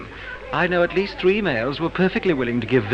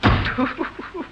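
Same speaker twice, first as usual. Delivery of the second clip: breathy laugh; 11 semitones higher than usual. Acted. Larry laughs leaving party.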